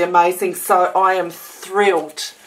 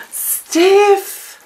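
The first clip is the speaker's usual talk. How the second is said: high, louder stunned cry of her name; astonished exclamation; 11 semitones higher, 4 dB louder than usual. Real, not acted. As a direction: squeal the name in real amazement. Overwhelmed by a surprise gift.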